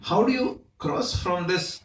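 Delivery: ordinary delivery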